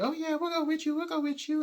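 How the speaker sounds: high falsetto mockery of fair-weather folks; mock eager promise. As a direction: parody empty promises in a high voice. People faking agreement, mocking.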